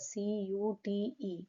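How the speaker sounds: slow, soft spelling of each letter; naming the letters C U T E slowly; spelled out letter by letter. Shown as a demonstration. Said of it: "Though there are four letters".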